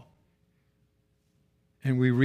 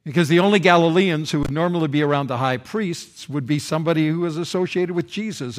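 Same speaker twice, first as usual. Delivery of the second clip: ordinary talk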